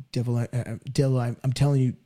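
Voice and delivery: low, feeble mumble to the devil; mock a timid believer, voice sunk low and weak; barely daring to speak up; timid, neutered cat. Shown as a demonstration. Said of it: "Are you some timid, neutered cat".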